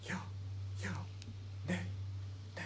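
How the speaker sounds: Ya and nay said on an in-breath; inhaled yes and no. Shown as a demonstration. Teacher demonstrating inhaled yes and no, breathing in. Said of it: "may be said with an inhalation".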